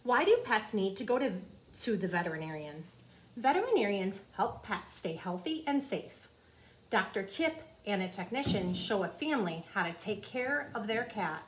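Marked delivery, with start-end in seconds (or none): none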